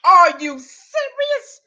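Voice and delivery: slow, stretched incredulous cry; are you serious, drawn out; mock disbelief. Real, not acted. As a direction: drag it out in disbelief.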